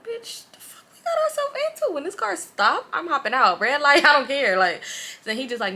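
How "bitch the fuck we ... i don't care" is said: panicked homegirl. Retold or imitated. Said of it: "my homegirl like".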